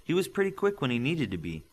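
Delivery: ordinary delivery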